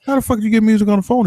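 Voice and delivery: rushed high incredulous question; astonished. Retold or imitated. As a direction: blurt it out in disbelief. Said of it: "And he was like".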